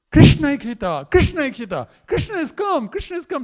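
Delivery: high-pitched jubilant cries; excited gopis calling out. Acted. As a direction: cry out the news, voice leaping up. Gopis cry out at Krishna's return.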